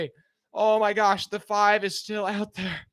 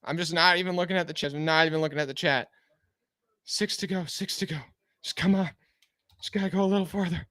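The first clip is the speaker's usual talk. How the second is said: out of breath; 5 semitones lower, 4 dB quieter, faster than usual. Real, not acted. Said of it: "I sound so out of breath".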